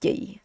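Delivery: "Yi" said short and hard with no air; dry unaspirated yi. Shown as a demonstration. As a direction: say "Yi" hard and dry, no breath pushed out. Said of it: "We just say".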